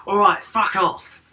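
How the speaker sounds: high, loud, slow telling-off; mock shout. Son snapping at his dad in the game, mock loud yell. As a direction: bellow it at the dad. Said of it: "but he goes".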